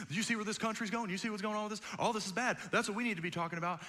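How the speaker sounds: fast, urgent nagging voice; pushy churchgoer, rushed. Retold or imitated. A grocery-line churchgoer pesters the pastor, fast and urgent. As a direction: rattle off the demands in a hurry. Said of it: "someone will be like".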